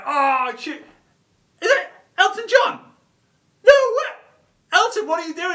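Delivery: very high, hammed-up disbelief; starstruck amazement. Passer-by recognising [name] in the car, starstruck. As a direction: squeal like a fan spotting an idol.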